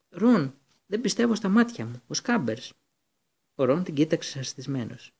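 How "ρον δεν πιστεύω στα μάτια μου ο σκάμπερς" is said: high shocked shriek of a name. A narrator's reading. Hermione spots Scabbers in the milk jug, high shriek. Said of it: "έμπηξε μια στριγλιά".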